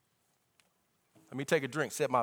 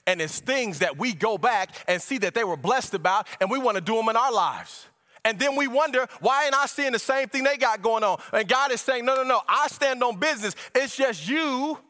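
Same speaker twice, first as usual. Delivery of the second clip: higher, driving preacher's voice; fervent preaching; 8 semitones higher, 5 dB louder than usual. Acted. Preacher voicing God's answer to church, fervent preaching. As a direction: press the point home, raised pitch.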